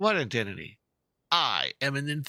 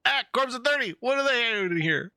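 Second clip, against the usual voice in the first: startled exclamation; 9 semitones higher than usual. Acted. Thug panics hearing the mercenaries' name, high startled cry. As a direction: pitch jumps high in alarm.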